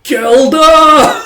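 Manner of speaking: high, loud mock war cry; triumphant battle cry. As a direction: shout it like a victory pose. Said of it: "does a little pose like".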